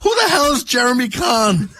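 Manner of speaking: hammed-up furious friend, half laughing. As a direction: yell it in outraged disbelief. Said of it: "He's furious. Just furious".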